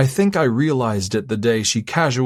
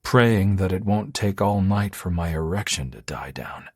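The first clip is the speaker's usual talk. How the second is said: ordinary delivery, nothing marked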